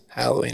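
Halloween said softly with the "a" of Hal; first syllable like Hal. Shown as a demonstration. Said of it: "I say Hal".

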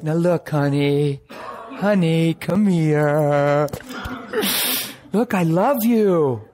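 slow, gentle comforting voice; loving father reassuring gently; tender father. Acted. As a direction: soothe a crying child. God comforting him tenderly.

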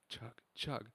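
normal talking voice

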